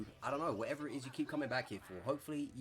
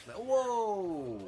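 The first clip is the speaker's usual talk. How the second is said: high loud startled whoa; sudden shocked outburst; surprised; 10 semitones higher, 8 dB louder than usual. Real, not acted. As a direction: yelp it in shock. Reacting to a sudden attack.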